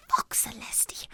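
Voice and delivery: slow, breathless whispered curse. Acted. Friend curses quietly, shocked by the news, whispered. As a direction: let it slip under your breath. Despairing aside while comforting.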